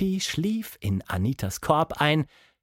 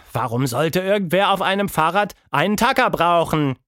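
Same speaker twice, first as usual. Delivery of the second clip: exasperated, raised-pitch complaint; exhausted grumble; 6 semitones higher, 5 dB louder than usual. A narrator's reading. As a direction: grumble loudly at a useless gadget. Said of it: "ein erschöpftes Grummeln". (genervtes Grummeln)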